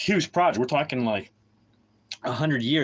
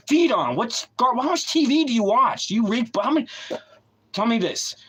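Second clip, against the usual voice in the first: furious rant; 7 semitones higher than usual. Real, not acted. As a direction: blow up at the audience.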